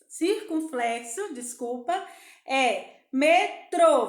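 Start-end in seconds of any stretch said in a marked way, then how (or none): 3.1-4.1 "metrô" slowly split, with a loud "trô"; stressed last syllable. Shown as a demonstration. Teacher picking out the syllable to colour, stress on "trô". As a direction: stretch and punch "trô" in "metrô". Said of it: "você vai pintar o tró".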